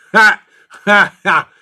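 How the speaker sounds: three loud, high "Ha!" laughs; loud laughing bursts. Real, not acted. Laughing hard at a chat joke.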